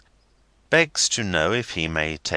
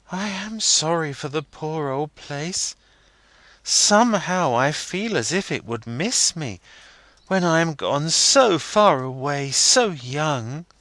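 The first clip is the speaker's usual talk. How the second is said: wistful sorrow; 9 semitones higher, 4 dB louder than usual. A narrator's reading. Rosa sorry for the old house.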